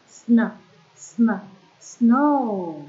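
sn, sn hissed then snow said loud; sounding out the blend. Shown as a demonstration. Teacher to young pupils, sounding out sn before snow. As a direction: push out sn twice, then say snow in full. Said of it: "sounding out onsets".

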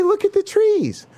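high, sing-song mocking biologist. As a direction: parody a preachy biologist. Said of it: "They just say".

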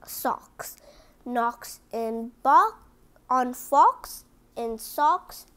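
usual speaking voice

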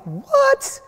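high, stunned "What?"; the husband can't believe his ears; shocked disbelief. Retold or imitated. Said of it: "He's like".

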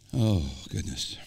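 ordinary talk

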